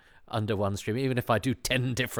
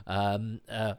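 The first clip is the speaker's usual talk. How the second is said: normal talking voice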